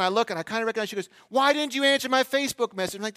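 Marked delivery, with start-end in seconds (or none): 1.3-3.0 high mock woman's voice, demanding; confronting woman. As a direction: imitate a woman calling someone to account. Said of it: "I hear a female voice from a car".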